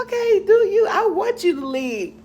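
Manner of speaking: high mock retort. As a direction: fire back in a high mock voice. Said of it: "Then I said".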